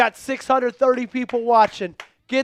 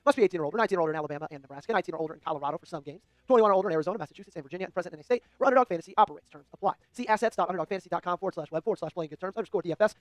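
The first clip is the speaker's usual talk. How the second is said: fast, softer, low legal fine print; rushed legal disclaimer; 3 semitones lower, 8 dB quieter, much faster than usual. Acted. Host speeds through gambling disclaimer to viewers, fast and soft. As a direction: rattle off the terms as fast as possible.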